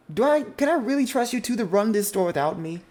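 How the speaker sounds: high, loud exasperated lecture; fed up, doubting them; exasperated scolding. Acted. Older sister doubts her siblings can run the store.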